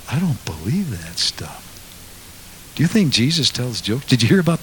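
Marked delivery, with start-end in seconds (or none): 0.1-1.6 hushed whisper; a quick whispered aside. Real, not acted. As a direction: whisper it like a confession.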